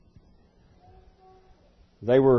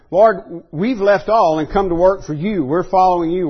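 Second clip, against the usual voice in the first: higher, louder disciple making his case; Peter; 6 semitones higher, 6 dB louder than usual. Acted. Disciple telling the Lord what they left, raised insistent voice. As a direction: plead the case in a raised, emphatic voice. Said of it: "So Peter's saying".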